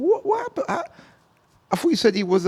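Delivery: high-pitched puzzled retelling; baffled question. Retold or imitated. Storyteller asks people about Corn's return, high baffled question. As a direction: act out disbelief, voice shooting up high.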